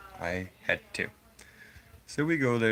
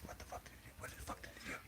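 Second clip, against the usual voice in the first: hushed, voiceless whisper to a buddy; imitating shocked campers whispering; whispered disbelief; 19 dB quieter, much faster than usual. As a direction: whisper it like a stunned kid. Retold stunned reaction among campers.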